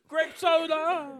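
mock vendor call.

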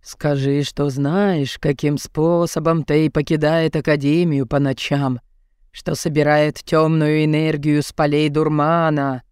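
low, slow wailing ghost; ghostly howl. A narrator's reading. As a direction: moan it like a wailing spirit. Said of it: "От звуков завывания призрака".

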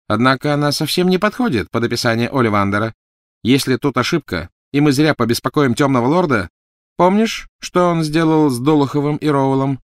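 high, loud, insistent woman's voice; cold wife, warning. Acted. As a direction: warn with rising urgency. Voices Narcissa in the story. (высокий, тревожно-настойчивый женский голос)

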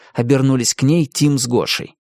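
usual speaking voice

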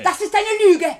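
schriller Aufschrei, viel höher und lauter; empörter Schrei. Acted. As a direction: schrei die Anschuldigung wütend zurück. Ertappte Täterin schreit Vorwurf zurück. (Frau Baker schreit den Detektiv empört an)

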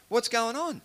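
mock bewildered call; puzzled blind beggar. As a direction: call out a puzzled question over crowd noise. Voicing blind Bartimaeus asking the crowd.

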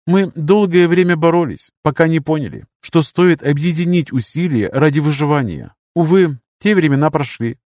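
gently lifted, courteous mentor. Acted. Lirius speaking.

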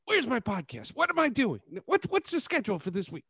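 high panicked questions; rattled, voice shooting up. Retold or imitated. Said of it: "I'm like".

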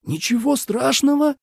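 high-pitched incredulous echo; breathless indignation. A narrator's reading. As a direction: repeat it aghast, voice shooting up. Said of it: "задохнулся Минлу". (задыхаясь от возмущения)

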